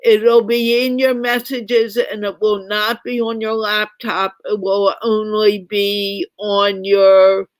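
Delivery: normal talking voice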